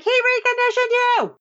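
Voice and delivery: very high, exaggerated accusing cry; hammed-up shock at a betrayal; mock outrage. Fan imagining the line he wanted, very high mock cry. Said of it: "I'd have been like".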